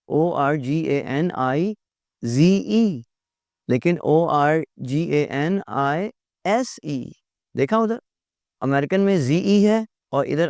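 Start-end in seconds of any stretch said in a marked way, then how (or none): 0.1-3.0 slow, letter by letter spelling. Shown as a demonstration. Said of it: "organise". 4.0-7.1 slow, letter by letter spelling. Shown as a demonstration. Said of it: "organise".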